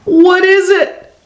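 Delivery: high, mildly scared question. A narrator's reading. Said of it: "whispers Rosie, feeling scared".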